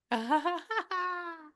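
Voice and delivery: long high-pitched burst of laughter; high, drawn-out laugh. Real, not acted. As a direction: laugh out high and let it run. Medium laughs at the spirit.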